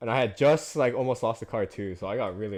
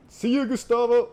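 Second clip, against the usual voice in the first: loud, high shouted farewell; gleeful call-out; 11 semitones higher, 7 dB louder than usual. Real, not acted. Racer calling out to rival Gustavo, loud and high. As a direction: shout a goodbye at a passing rival.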